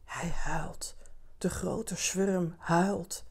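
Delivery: hushed whisper, very slow at the end. A narrator's reading. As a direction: whisper it like awed reporters. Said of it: "in hun microfoons fluisteren". (zacht gefluisterd, heel langzaam)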